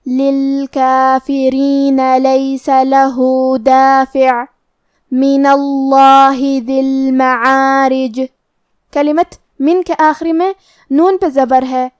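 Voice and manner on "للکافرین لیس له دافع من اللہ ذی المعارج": slow, drawn-out tajweed chant; Quran recitation. Acted. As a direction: recite the verses slowly and melodically. (قرآن کی تلاوت، آہستہ اور لمبی)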